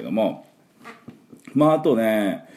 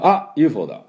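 startled 「あ」 higher and louder; surprised ah; 7 semitones higher, 5 dB louder than usual. Shown as a demonstration. Teacher demos a startled 「あ」 at spotting a UFO. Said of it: "みたいな".

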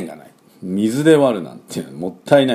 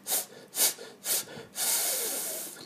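short bursts of air between tongue and teeth; English th sound demonstrated in puffs; voiceless th hiss; 20 dB quieter, much slower than usual. Shown as a demonstration. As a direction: let air leak softly, no voice. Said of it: "舌を軽く挟んで空気を抜きます".

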